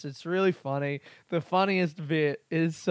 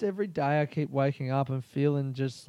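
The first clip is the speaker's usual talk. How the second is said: normal talking voice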